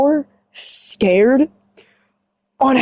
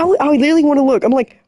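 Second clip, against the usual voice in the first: ordinary delivery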